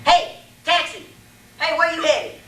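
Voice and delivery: hailing a taxi. Acted. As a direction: call out to a passing taxi.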